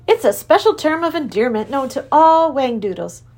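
high, jolly creature king; a cheerful high king; Wangdoodle king. Acted. King explains endearment.

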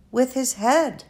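lightly voiced exasperated girl; a hint of girlish impatience; Flora. Girl exasperated at the old doctor, mild.

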